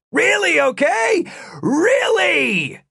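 shrill, exasperated shouting. A narrator's reading. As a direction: scream it, totally fed up. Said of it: "the guard shouted".

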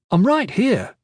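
put-on a mildly annoyed boy; irritated. Kip reminding the adults he's present, irritated. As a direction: cut in, a little put out.

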